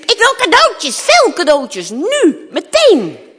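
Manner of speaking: put-on loud, high spoilt-child demand; demanding shout. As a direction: shout it as an impatient princess. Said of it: "Ze riep". (eisend, luid roepen)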